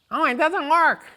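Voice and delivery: high, loud mock confession; Lou's high voice. Lou admits the AC is broken, high and loud. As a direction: pip it out as Lou.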